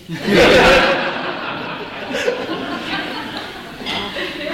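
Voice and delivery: airy laugh with no voice; shy airy laugh; breathy laugh. Real, not acted. Laughing at his own story.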